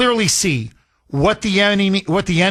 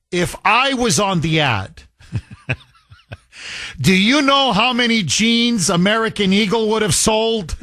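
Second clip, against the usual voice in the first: chuckling through a quoted joke, pitched higher by the end; laughing while reading; 4 semitones higher than usual. Real, not acted. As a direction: break into laughter as you read it out. Reads sister's joke while laughing.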